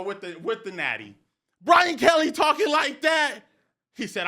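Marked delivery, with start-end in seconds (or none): 1.6-3.4 loud, high hype holler; fired-up shouting. Real, not acted. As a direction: shout it in amazed excitement. Repeats it, fired up.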